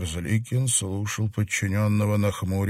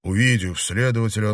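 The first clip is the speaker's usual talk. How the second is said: ordinary delivery, nothing marked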